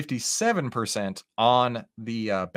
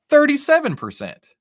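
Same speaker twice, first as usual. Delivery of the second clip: excited reveal; 13 semitones higher, 8 dB louder than usual. Real, not acted. Result pops up on chart.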